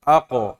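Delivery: Ako with the qaf bounce rounded to O; thick qalqalah on qaf. Shown as a demonstration. Teacher models qaf bounce for students, rounded O. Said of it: "berarti bacanya gimana".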